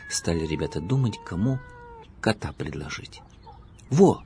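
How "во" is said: higher, louder eureka shout; exclaiming. A narrator's reading. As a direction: blurt out the idea. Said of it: "воскликнул Павлик".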